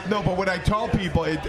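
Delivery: ordinary delivery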